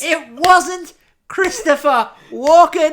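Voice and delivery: high, loud and slow, word by word; insistent mock outrage. Real, not acted. Disappointed fan repeating the complaint to co-host, insistent shout. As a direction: drive every word home at full volume.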